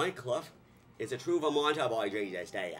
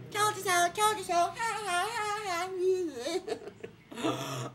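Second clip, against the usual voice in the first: gloating taunt; slower than usual. Retold or imitated. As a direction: taunt him, high and gleeful.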